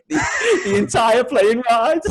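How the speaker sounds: laughing through the words. Real, not acted. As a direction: giggle out the ending. Laughing while telling.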